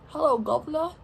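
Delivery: playful, badly faked British accent; attempted British accent. Shown as a demonstration. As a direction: have a half-unsure go at a British accent. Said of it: "Is that, like, British or something?".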